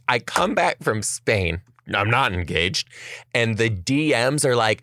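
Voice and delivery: normal talking voice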